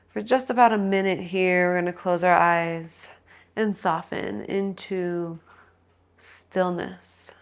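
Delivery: soft, calm relaxation guidance; guided meditation. Acted. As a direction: ease them into stillness. Guides final Shavasana rest.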